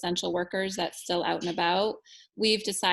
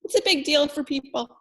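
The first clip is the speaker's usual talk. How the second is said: emotional; 7 semitones higher, 4 dB louder than usual. Real, not acted. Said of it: "I didn't mean to get all emotional".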